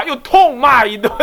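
high, slow, laughing through it; real laughter while retelling. Real, not acted. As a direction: chuckle as you tell it.

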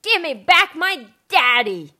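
loud, slow demanding call; girl yelling for her father; yelling down the hole. Acted. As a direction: shout it down into the ice. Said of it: "She yelled down the hole".

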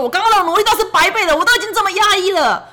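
put-on frustrated child. Son's imagined frustrated thought.

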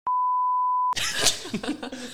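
very high, loud laugh; squealing laugh. Real, not acted. Guest cracks up over what can't be said, squealing laugh.

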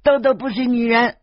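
put-on high, loud little girl's voice; little girl butting in. Little girl correcting the adults, in a high loud child's voice. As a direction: pipe up like a cheeky small girl. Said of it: "小魔女插嘴说".

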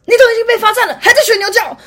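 very high, very loud teacher rebuke; teacher scolding the boy at the blackboard; angry scolding. Retold or imitated. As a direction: bark it down at the wrong boy. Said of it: "老师就骂他说".

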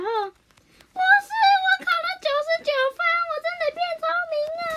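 put-on very high, rushed, loud kid shouting; a child bursting with news of his score; 兴奋报喜. 绿豆冰考了九十九分. (很高很快的童声，兴奋报喜)

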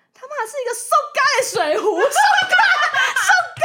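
very high, loud, dragged-out shout; outraged laughing shout. Real, not acted. 揭晓第二个礼物. (拉长声音又笑又气地大叫)

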